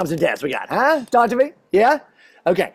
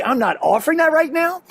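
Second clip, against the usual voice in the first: higher, brisker quoted refusal; quoted parenting line; 7 semitones higher than usual. Retold or imitated. Dad explaining the trick to parents, raised pitch. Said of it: "But if you say".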